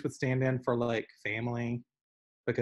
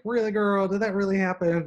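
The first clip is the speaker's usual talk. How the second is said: incredulous; 8 semitones higher, 6 dB louder than usual. Retold or imitated. Retelling hometown stories, incredulous.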